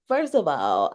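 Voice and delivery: ordinary delivery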